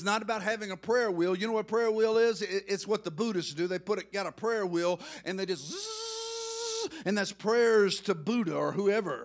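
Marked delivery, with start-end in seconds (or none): 5.2-6.9 high, slow whirring buzz; spinning whirr. Retold or imitated. Preacher mimicking a Buddhist prayer wheel, high drawn-out buzz.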